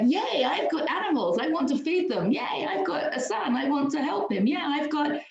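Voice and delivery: high, bright cheerful imitation; gleeful mock excitement; happy voice. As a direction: sing it out like a delighted child. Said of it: "It's like".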